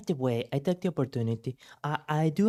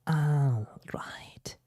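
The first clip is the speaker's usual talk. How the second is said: soft unvoiced whisper; 2 semitones higher, 3 dB quieter than usual. Real, not acted. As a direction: breathe it out in a whisper. Whispered aside between topics.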